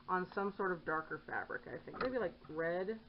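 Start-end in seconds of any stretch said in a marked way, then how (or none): none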